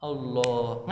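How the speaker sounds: hushed reply in a teaching role-play; reverent answer. Shown as a demonstration. Answering as the taught child.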